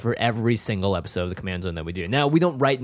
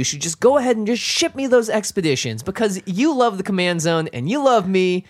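high, loud, gushing hype voice; overexcited fan-pleading pitch, sung out; nerd voice; 8 semitones higher, 4 dB louder than usual. Pretend. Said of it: "with the nerd voice".

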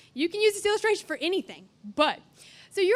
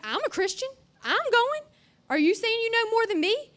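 mild mock indignation; 6 semitones higher, 4 dB louder than usual. Angry friends confront him.

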